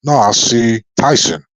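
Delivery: ordinary delivery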